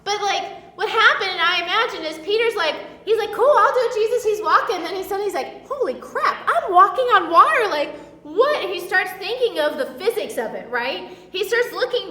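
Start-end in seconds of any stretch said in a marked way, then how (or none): 3.3-4.2 eager Peter. Retold or imitated. Peter eager, acted quote. 5.7-8.6 amazed Peter. Retold or imitated.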